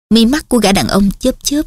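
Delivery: plain reading voice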